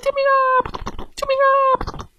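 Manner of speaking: very high squealing cries; fish screaming for help. Acted. Goldfish circling the bear, screaming high for help. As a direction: shriek for help like a tiny fish. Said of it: "拼命地喊着".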